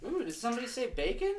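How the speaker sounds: high, excited squeal; Indy impression. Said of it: "As Indy says".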